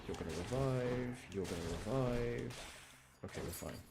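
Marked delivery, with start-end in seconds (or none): none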